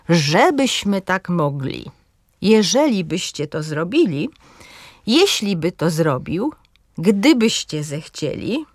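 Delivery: mocne początki spójników: „ŻEbyśmy”, „GDYbyście”; „Żebyśmy, Jeżeli byście, jeśli by” z akcentem na ŻE, ŻE, JE; akcent na trzecią sylabę. Shown as a demonstration. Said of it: "akcent pada na trzecią sylabę". (akcentuj „ŻEbyśmy, jeŻElibyście, JEśliby, GDYbyście”, cząstka „by” słaba)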